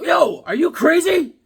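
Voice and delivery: high, loud, drawn-out disbelief; retold indignant yell. Retelling answering the door at three AM, indignant yell. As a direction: yell it incredulously, slowed and high.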